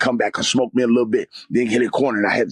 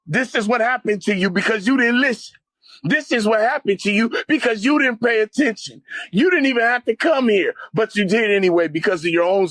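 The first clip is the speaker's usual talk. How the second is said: accusing tormentor; 7 semitones higher than usual. Retold or imitated. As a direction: hurl the blame at them like a tormentor.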